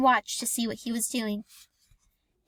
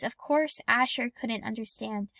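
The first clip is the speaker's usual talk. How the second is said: ordinary delivery, nothing marked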